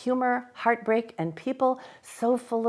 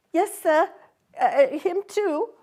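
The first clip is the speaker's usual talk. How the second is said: put-on timid child; 8 semitones higher, 4 dB louder than usual. A narrator's reading. Frightened Pip.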